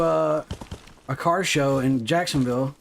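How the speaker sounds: ordinary talk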